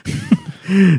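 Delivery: high-pitched burst of laughter. Real, not acted. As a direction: squeal with laughter. Laughing at own impression.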